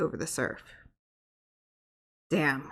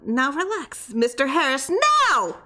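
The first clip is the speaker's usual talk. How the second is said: much higher, rising to a shrill shout; high shrill command; 8 semitones higher than usual. A narrator's reading. Creature orders the patient to relax, climbing to a shrill shriek. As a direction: squeal the last word. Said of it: "high and shrill".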